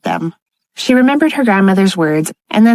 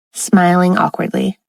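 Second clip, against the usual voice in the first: usual speaking voice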